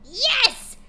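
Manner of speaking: high, loud triumphant yes; excited cry. A narrator's reading. Pony cheering as her dream comes back, loud and high. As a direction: burst out in pure joy. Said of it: "she cried out".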